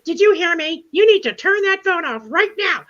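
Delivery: high, loud mock scolding; mock customer yelling at her to turn off the phone; angry scolding. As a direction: imitate the lady demanding the phone go off. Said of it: "And she goes".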